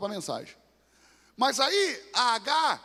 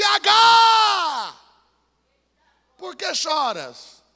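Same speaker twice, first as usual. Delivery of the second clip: loud, high shouted call, slowing at the end; booming angel; 9 semitones higher, 13 dB louder, much slower than usual. Acted. Angel calling to weeping Hagar, booming shout. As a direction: call down from heaven to a weeping woman.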